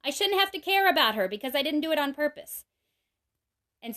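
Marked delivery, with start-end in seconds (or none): 0.0-2.6 high, loud mock whine of a kid; defensive kid. Mom imitating kids quick to excuse themselves with a loud high whine. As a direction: whine it like a child dodging blame.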